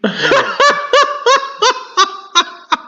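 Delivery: very high giggling shriek; shrieking laugh. Real, not acted. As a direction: squeal with laughter. Laughing at mic mixup.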